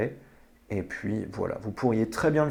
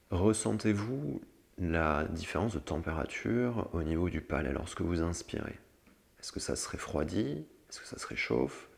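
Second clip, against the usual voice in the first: guided meditation; 4 semitones lower, 8 dB quieter than usual. Acted. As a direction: lead them gently into calm. (voix douce de méditation guidée)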